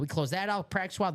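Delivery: normal talking voice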